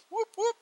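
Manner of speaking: very high-pitched double whoop; high whoop-whoop cheer. Real, not acted. Cheering a character on the list with high whoops.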